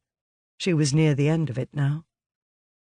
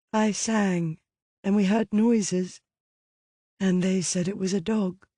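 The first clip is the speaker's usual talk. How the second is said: low and tired; 5 semitones higher than usual. Acted. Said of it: "Her voice was low and tired".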